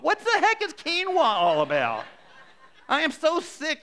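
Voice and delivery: high, loud comic rant; hammed-up exasperation. Preacher ranting to congregation about quinoa, mock exasperated. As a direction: let mock outrage send the pitch way up.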